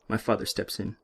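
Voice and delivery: usual speaking voice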